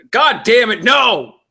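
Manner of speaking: high, loud real exasperation; exasperated outburst. Real, not acted. Reader breaking off the story mid-line, exasperated. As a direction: burst out in frustration at your own slip.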